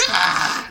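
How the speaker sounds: strangled, hammed-up effort noise; mock straining cry. Villain still losing to Jean, mock straining. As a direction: strain hard on a wordless cry. Acting Magneto straining again.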